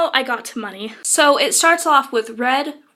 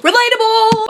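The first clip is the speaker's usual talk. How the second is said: high, loud mock cheer of relatable; catchphrase shout; 9 semitones higher, 7 dB louder than usual. Vlogger shouting her running joke: high loud relatable. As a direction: belt out relatable as a silly catchphrase.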